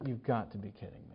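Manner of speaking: very soft, low aside; stunned disbelief. Retold or imitated. Retelling his look at the friend skipping the movie, hushed disbelief. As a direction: imitate speechless disbelief under the breath. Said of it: "I just looked at him like".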